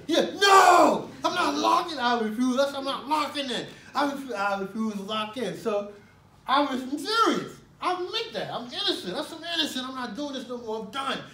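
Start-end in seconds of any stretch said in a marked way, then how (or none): 0.1-5.6 loud, high defiant yelling that cools at the end; furious refusal. Retold or imitated. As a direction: refuse him outright, fed up. Retold furious refusal to guard.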